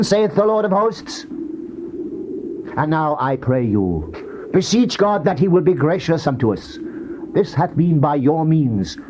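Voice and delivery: ordinary talk, nothing marked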